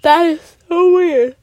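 talking through a yawn; stretched out mid-yawn. Real, not acted. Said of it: "I don't know why I'm yawning right now".

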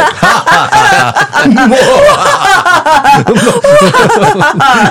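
big, high, loud belly laugh; laugh as favorite sound. Shown as a demonstration. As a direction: burst into a long, loud cackle. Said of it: "I guess laughter is my favorite sound".

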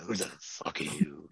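low, gruff mock Kate Mulgrew snap. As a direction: drop deep into her commanding growl. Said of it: "It's that type of voice".

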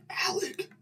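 hushed stage whisper; urgent whisper. Retold or imitated. Diner alerts friend beside a stranger, urgent whisper.

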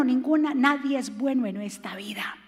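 hushed and breathless, nadie es bueno; sermon aside whispered low. Real, not acted. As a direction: drop to a near whisper for the point. Preacher drops to a whisper.

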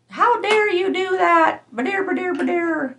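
high, soft, slowed-down mock outrage; mocking indignant imitation. Mimicking someone offended at being rebuked, high mocking voice. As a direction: mimic their complaint in a high put-on voice, sliding into nonsense babble.

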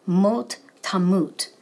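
"mot tamut" said slowly in Hebrew, each syllable spelled out; teacher modelling "mot tamut" slowly for the class; Hebrew pronunciation. Shown as a demonstration. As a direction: carefully voice "mot tamut" as Hebrew, slow and deliberate. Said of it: "it's emphatic".

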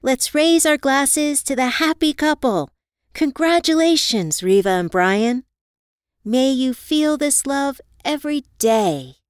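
a best friend toasting the happy couple, festive and loud. A narrator's reading. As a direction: lift the glass and beam out the toast. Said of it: "I toasted".

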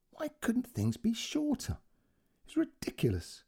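fed-up whispered aside; irritated whisper. Acted. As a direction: hiss the frustration under the breath.

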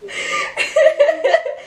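long high peal of laughter. Real, not acted. Cracking up at the friend's joke, high laugh. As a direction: let a big laugh roll out.

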